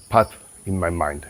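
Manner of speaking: normal talking voice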